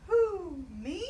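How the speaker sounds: high loud hoot of surprise; surprised. A narrator's reading. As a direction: hoot it out amazed like an owl. Owl surprised by offer.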